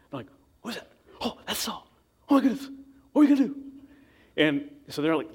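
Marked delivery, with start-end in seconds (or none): 0.6-3.6 high, rising panic, growing louder; frightened men fussing, then near shrieking; panicked whisper-shout. Acted. David's men seeing Saul enter the cave, panicked high voices.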